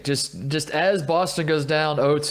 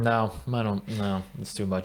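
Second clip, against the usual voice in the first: mom, soft and weary; 4 semitones lower, 6 dB quieter than usual. Retold or imitated. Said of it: "She's like".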